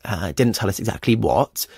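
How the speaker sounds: ordinary delivery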